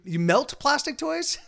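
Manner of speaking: high, slow, laughing disbelief; can't believe it, laugh it out; delighted shout of amazement; surprised laugh. Real, not acted. Amazed by viewer's hobby.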